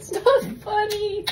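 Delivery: giggling, hitting not; can't keep a straight face; laughing complaint; laughing protest. Real, not acted. Laughing after the prank.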